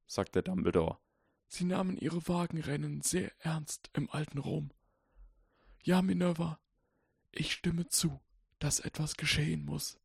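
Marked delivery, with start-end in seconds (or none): 1.5-10.0 put-on softly reciting old wizard. A narrator's reading. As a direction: murmur it like ancient verse. Dumbledore voiced in story.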